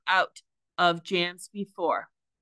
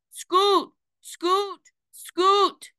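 much higher and louder, a desperate repeated yell; 12 semitones higher, 5 dB louder, much slower than usual. A narrator's reading. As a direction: shout her name down through the hull.

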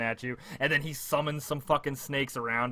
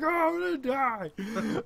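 slow, high-pitched wail; hammed-up doomed cry; mock dread; 12 semitones higher, much slower than usual. Player facing a massive troll, mock despairing.

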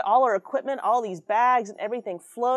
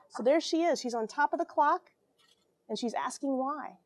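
ordinary delivery

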